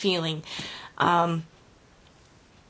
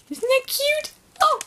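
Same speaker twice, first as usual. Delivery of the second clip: pitched way up, delighted; high gushing delight; high cutesy gush. Real, not acted. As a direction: squeal over something adorable. Gushing over gift bag.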